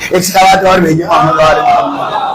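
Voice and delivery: calling out for the congregation to answer; salawat call. Real, not acted.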